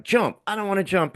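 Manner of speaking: put-on a child frozen at the pool's edge; scared kid. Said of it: "you're like".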